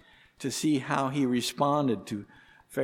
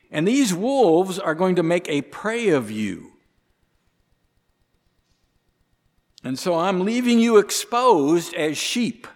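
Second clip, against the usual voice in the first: emphatic quote; 6 semitones higher, 7 dB louder than usual. Acted. Voicing Jesus' warning aloud.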